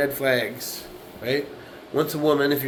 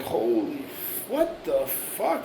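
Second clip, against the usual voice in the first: shocked; 4 dB quieter, much slower than usual. Retold or imitated. Imitating his shock at screaming.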